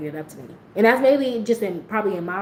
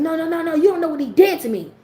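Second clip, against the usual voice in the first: upset inmate; 9 semitones higher, 4 dB louder than usual. Retold or imitated. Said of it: "And she was like".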